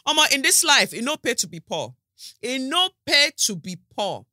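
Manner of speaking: loud Nigerian pidgin, rising in pitch; Nigerian pidgin lament. Pretend.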